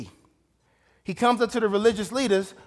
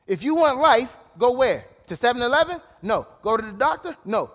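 normal talking voice

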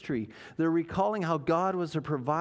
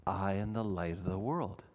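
hushed solemn quote; 9 semitones lower, 6 dB quieter than usual. Acted. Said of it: "Jesus says the words".

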